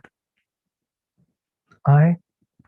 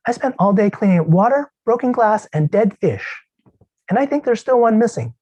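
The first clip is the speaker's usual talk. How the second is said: higher, complaining wife calling from another room; nagging wife; 9 semitones higher than usual. Acted. Wife calls from the kitchen to complain about the daughter, higher and nagging. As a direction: rattle off the day's grievances at him. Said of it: "she called to me from the kitchen".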